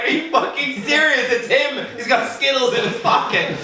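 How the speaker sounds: high outraged schoolboy voice; hammed-up indignant classmate; indignant friend. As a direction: protest and point the finger at Harry. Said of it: "And then Jack's like".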